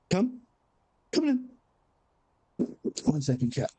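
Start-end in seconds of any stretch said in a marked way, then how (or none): 0.1-1.5 much higher, sing-song come; high coaxing call. Real, not acted. Owner calling his cat in, high and coaxing. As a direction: coax the cat in.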